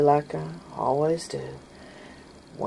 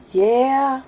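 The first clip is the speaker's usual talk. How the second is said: put-on child's reply; 10 semitones higher, 6 dB louder than usual. Child answering mom.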